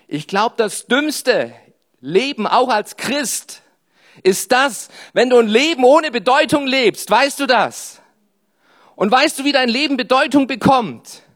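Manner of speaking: loud, high fervent preaching. Acted. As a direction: preach it with full force. Preaching at full force.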